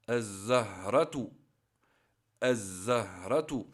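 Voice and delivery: slow "az-zahratu" with a long buzzing doubled Z; lam silent. Shown as a demonstration. Teacher showing the lam is not pronounced, doubled Z in "az-zahratu". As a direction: say "az-zahratu", no L, holding the Z. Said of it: "On ne prononce pas le lem".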